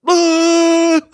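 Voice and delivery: high, loud hammed-up shriek; mock yell. Host imitates Cage's sudden shriek before the kill. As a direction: let out a crazed shriek. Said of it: "Nicolas Cage yells".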